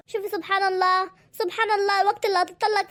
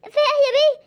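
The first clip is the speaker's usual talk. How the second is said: alarmed shout; 8 semitones higher, 5 dB louder, faster than usual. Acted. Alarmed neighbour rushes in.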